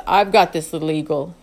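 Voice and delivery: a wise elder turning in for the night; Edgar's calm deep voice. A narrator's reading.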